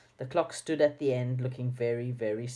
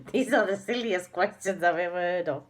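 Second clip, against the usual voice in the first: laughing; 4 semitones higher than usual. A narrator's reading. Amused at silly questions.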